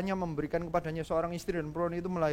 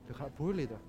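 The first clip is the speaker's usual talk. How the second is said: dazed blank remark; 6 semitones lower, 7 dB quieter than usual. Retold or imitated. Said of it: "saya cuma bengong aja".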